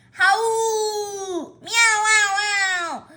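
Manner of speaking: high loud howl; howling cry. Acted. Puppy and Kitty howling together, high. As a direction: howl like a weeping dog. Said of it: "caterwauling and howling".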